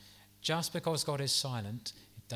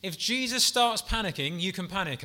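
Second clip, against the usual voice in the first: higher, louder punchline; emphatic build; 5 semitones higher, 5 dB louder than usual. Real, not acted. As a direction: drive the point home with energy. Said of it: "what comes to mind here".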